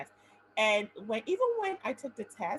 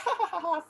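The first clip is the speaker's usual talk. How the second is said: forced, louder mock laugh; fake laugh; 9 semitones higher, 4 dB louder, faster than usual. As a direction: laugh it off, higher. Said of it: "I was like".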